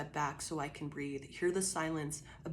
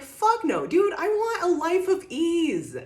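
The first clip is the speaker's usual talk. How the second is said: high, loud, half-laughing refusal, slowing down; mock refusal; 12 semitones higher, 9 dB louder, slower than usual. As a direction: wail the refusal like a spoiled kid. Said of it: "you're like".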